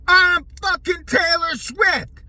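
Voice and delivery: hammed-up furious yelling player; mock screaming. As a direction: roar it at the old coach like a maniac. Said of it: "he was probably screaming that to the guy".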